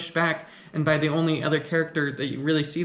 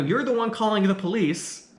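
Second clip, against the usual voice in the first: usual speaking voice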